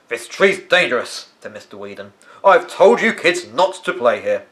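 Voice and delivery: put-on gardener scolding children away from the fallen tree; gruff gardener.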